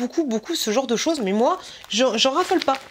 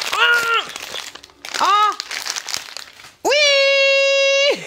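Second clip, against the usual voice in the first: excited shout; 14 semitones higher, much slower than usual. Real, not acted. Finding a beloved candy in the gift box, real excited shout. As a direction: burst out with delight. Recognising a favourite product.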